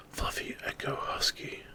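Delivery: ordinary delivery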